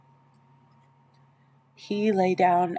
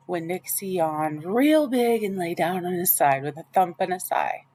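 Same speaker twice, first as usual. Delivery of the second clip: plain reading voice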